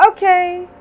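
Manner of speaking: put-on bright, high, loud okay from a perked-up kid; cheerful child's reply. A narrator's reading. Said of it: "With a smile, he responded".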